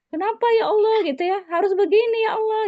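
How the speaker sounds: high, loud wailing protest at fate; lamenting complaint to God. Retold or imitated. Teacher voicing a grieving person who resents fate, high loud lament. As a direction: cry out like someone who refuses their fate. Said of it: "Dia tidak ridho".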